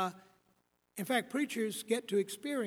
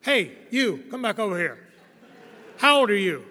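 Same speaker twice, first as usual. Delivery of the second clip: calling her back; 12 dB louder than usual. Retold or imitated. Preacher calling the departing lady back, loud call. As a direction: shout after her to come back. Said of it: "And I said".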